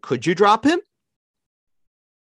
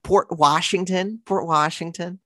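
put-on high, slow Valley girl uptalk; Valley girl accent; 6 semitones higher than usual. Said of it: "We're not fucking Valley girls".

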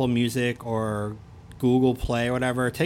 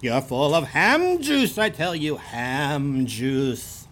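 exaggerated Fargo accent; much slower than usual. Shown as a demonstration. Repeating the insult after co-host agrees, exaggerated Fargo accent. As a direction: stretch it out as a hammed-up Fargo local. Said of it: "That's how they talk in Fargo".

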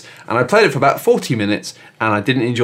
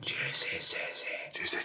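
slow, much softer whispered "Juicy Susie"; 18 dB quieter, much slower than usual. Acted. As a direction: breathe "Juicy Susie" out slowly as a secret whisper. Whispered take of the name.

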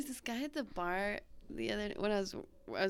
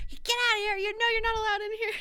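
high, loud, fast shout; acted-out furious bartender; bartender yelling; 8 dB louder, faster than usual. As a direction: yell it like throwing someone out. Bartender throwing out banned man.

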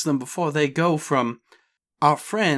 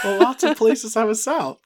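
higher Southern twang, hammed up; Southern accent; 7 semitones higher than usual. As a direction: play the Southern merc repeating her line. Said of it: "Southern Cragsmith just always sounds like this".

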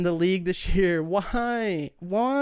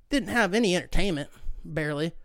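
normal talking voice

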